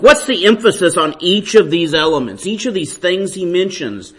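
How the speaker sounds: ordinary talk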